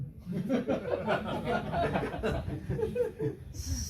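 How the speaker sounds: a high, breathy laugh at his own joke; high burst of laughter. Real, not acted. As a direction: laugh it off, amused at yourself. Laughs at own cheeky answer. (tawa tinggi terbahak)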